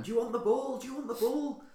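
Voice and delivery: high-pitched mock scream; imitated screaming coach. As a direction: shriek it like an exasperated football coach. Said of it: "the coach was screaming at him".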